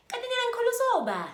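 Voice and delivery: high, loud outraged retort; indignant shout. Retold or imitated. Girl snaps back at a rude date, high and loud. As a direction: fire back, offended and loud.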